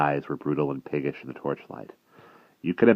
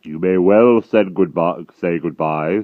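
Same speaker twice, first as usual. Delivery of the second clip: sneer; 3 semitones higher, 9 dB louder, slower than usual. A narrator's reading. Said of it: "sneered the giant".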